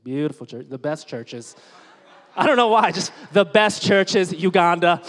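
a hint of Trump bragging; Trump impression. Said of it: "Just felt like Trump for a second".

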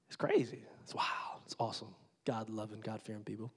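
hushed. Real, not acted. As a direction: lean in and whisper it. Hushed aside about Uganda.

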